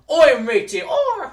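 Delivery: put-on British accent. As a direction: ham up a cartoon British accent, yelling. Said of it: "British accent".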